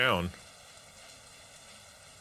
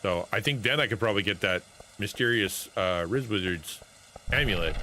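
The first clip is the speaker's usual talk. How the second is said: ordinary delivery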